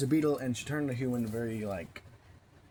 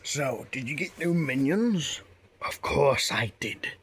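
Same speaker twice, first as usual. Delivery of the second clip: louder, slower hammed-up villain chat; playful character voices; 3 semitones higher, 4 dB louder, slower than usual. Villain introducing new minions, hammed-up. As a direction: ham up a villain and his minions.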